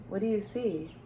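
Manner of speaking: plain reading voice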